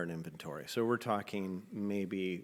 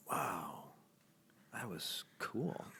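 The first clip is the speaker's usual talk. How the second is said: dazed wonder; 7 dB quieter, much slower than usual. Retold or imitated. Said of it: "I'm like".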